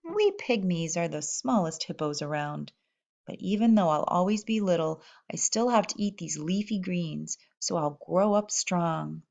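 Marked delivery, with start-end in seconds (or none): none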